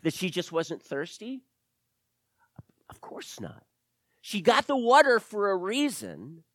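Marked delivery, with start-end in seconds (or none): 2.9-3.6 very soft, low, near-whisper; hushed aside. Real, not acted. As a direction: drop to almost a whisper to answer yourself. Answers own question in hushed aside.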